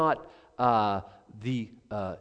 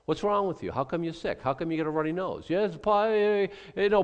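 higher, pushy mock preacher; prosperity preacher parody; 6 semitones higher, 3 dB louder than usual. Preacher mimics health and wealth gospel crowd, mock pushy. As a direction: ham up a prosperity preacher a bit. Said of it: "we get the health and wealth gospel".

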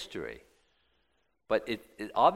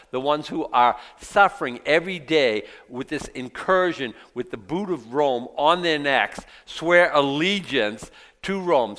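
louder, rising indignant sermon; forceful preaching; 4 semitones higher, 7 dB louder than usual. Acted. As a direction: hammer the outrage home.

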